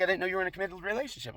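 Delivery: high, quick mock female voice; imitated woman. Neighbor questions him about the girl, high mimicking voice. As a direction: mimic her in a rushed high pitch.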